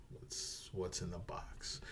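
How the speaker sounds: whispered, desperate question; hushed mock-desperate whisper. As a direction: breathe it out with no voice. Brad Pitt's desperate box question.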